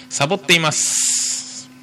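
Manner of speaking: title read with mock sulk. Reading the letter's title aloud.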